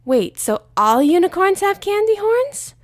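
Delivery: high, slow wide-eyed wonder; slow, high awed pony voice; amazed Pinkie. Acted. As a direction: gasp it out in awe.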